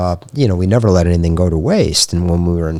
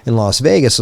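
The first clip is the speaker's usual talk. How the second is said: normal talking voice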